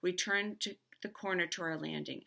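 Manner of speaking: ordinary delivery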